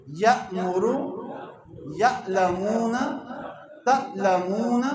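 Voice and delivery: یأمرو read slowly with the hamza caught sharply; recitation demo. Shown as a demonstration. Teacher recites hamza sakin examples to class. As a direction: recite each word with a clear glottal catch. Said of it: "یہ سارا قرآن میں موجود ہے".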